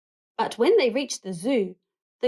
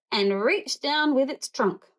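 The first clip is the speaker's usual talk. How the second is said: usual speaking voice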